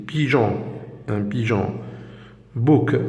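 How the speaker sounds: normal talking voice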